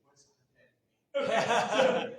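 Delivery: put-on a child puzzled by the word; kid asking back. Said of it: "they look at me and go".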